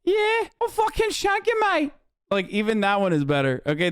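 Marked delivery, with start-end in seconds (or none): 0.0-1.9 very high British thug voice; Aiden voice. Acted. Streamer defending his British accent, high mock-threatening Brit. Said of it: "even my Aiden voice".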